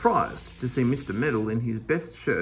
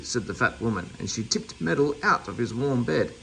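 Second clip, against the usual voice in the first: ordinary delivery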